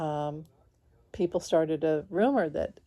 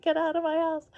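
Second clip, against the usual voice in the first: high-pitched mock yell like the meme lady; 12 semitones higher than usual. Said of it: "I was like that lady in that meme".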